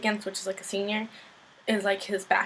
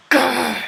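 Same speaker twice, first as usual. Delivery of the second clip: loud, higher mock growl of exasperation; frustrated growl; 4 semitones higher, 8 dB louder than usual. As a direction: growl out your exasperation at the story. Frustrated growl after ranting at character.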